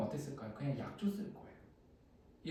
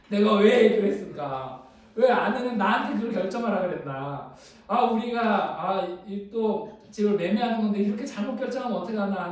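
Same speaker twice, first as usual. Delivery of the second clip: louder, higher replay of blaming oneself; retold self-reproach; 4 semitones higher, 8 dB louder than usual. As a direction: berate yourself, as if reliving it. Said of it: "자책이 올라왔습니다".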